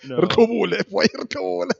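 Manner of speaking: hype call. Real, not acted. Hyping the rapper to perform.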